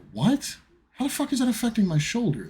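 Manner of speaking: incredulous outburst. Retold or imitated. Patient hearing spleen news, shocked and high. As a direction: ask it in total confusion. Told spleen may be damaged.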